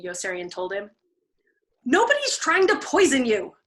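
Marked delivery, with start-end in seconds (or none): 1.8-3.6 high, loud exasperated protest; exasperated denial. A narrator's reading. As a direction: insist he's being absurd. Clevenger exasperated.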